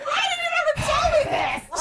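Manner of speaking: high, very loud outraged shriek; incredulous yell. Retold or imitated. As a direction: shout it in disbelief at a huge revelation. Acts out shocked yell at mom.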